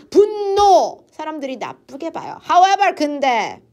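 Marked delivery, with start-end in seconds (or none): 0.1-1.0 a sudden sharp cry of 분노; loud exclamation. Shown as a demonstration. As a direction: burst out the word 분노 loudly and high. Exclaiming the word anger. (갑자기 크게 외치듯)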